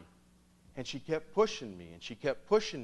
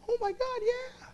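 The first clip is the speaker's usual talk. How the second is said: fake recognition. Feigned recognition, fake enthusiasm.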